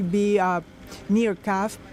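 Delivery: usual speaking voice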